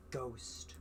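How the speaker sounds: spooky whisper. Acted. Spooky chant at episode start.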